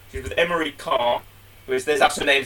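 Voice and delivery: normal talking voice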